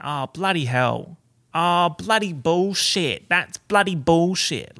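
drawn-out, emphatic Aussie swearing; Aussie exclamation. Shown as a demonstration. Showing how Australians say bloody, exasperated and drawn out. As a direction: stretch out each bloody with exasperation. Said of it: "in Australia, like, we say bloody".

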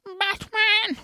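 put-on high-pitched old lady's cry; old woman. Old woman spots Batman, high cry. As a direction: call out as a startled old woman. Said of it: "They see this little old woman".

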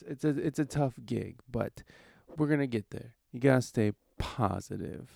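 normal talking voice

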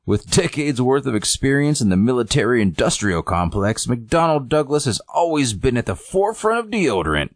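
high, swooping mock ad read; commercial announcer. Announcer pitches deodorant to viewers, hammed-up sales voice. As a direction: sell it with exaggerated voiceover lilt.

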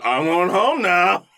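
slow, dazed, high patient; drug-fueled haze. Acted. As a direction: drawl it out woozy and laughing. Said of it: "in a drug-fueled haze going".

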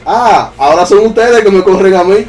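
high, loud taunt in retelling; retold yell. Dreamer yells at the fleeing spirit, high and loud. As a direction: raise pitch and volume as if yelling after them. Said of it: "yo le grité".

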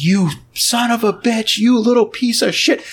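high-pitched retold angry outburst; mock fury. Boss curses the actor who betrayed him, mock fury. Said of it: "He is so mad at Elliot".

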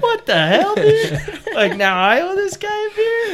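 high, loud, laughing disbelief; mock outrage. Storyteller replaying being stuck owing the guy a beer, mock outrage. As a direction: exclaim it incredulously, pitch shooting up through laughter. Retelling his baffled outburst at the client.

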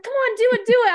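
high-pitched imitated peer pressure; egging on. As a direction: urge her on in a high voice. Imitates girls egging her on.

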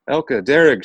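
high, loud call to her siblings; crying out. A narrator's reading. Girl carried away calls out to her siblings, loud high cry. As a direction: call back across the distance. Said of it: "she cried back".

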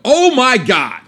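high exasperated oh my God off mic; re-enacted exasperation. Shown as a demonstration. Re-enacting his reaction to the stalled driver, high and off mic. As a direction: show them how you really said it. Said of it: "pull away from the mic and do what I did".

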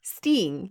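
STING said "steeng", with a long "ee"; teasing echo of "Sting" as "steeng"; imitated accent. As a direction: copy the friend's Sting, vowel as in "see". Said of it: "You say sting".

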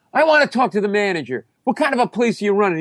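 high-pitched demanding complaint; worked-up customer demand; irate customer. Retold or imitated. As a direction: demand service in mock outrage. Acted complaint to counter worker.

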